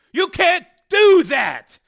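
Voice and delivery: very loud, high and slow shout; furious shout. Acted. Engineer shouting at the senator, furious. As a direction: roar it, jumping up. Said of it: "Higgins shouted".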